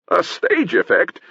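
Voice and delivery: high, drawn-out stage disbelief; astonished cry. A narrator's reading. Potion seller hearing of the trick, astonished cry. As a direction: echo it in theatrical amazement. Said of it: "cried the potion seller".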